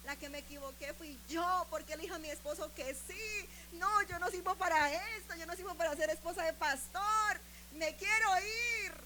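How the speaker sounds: building to a high, loud protest; retold desperate outburst. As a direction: complain to God, rising into a dramatic wail.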